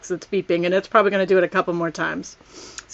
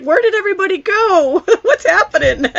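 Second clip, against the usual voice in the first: the puppy Flash lightly voiced, a little higher, laughing through it; voicing the dog; 10 semitones higher, 4 dB louder than usual. Owner voicing her dog left alone on his couch, amused and lightly pitched up. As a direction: play the confused little dog, half-laughing. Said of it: "He's like".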